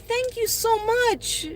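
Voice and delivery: high, slow heartfelt thanks; high drawn-out thank you; gushing thanks. Real, not acted. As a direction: draw out each word, deeply grateful. Thanking the viewer.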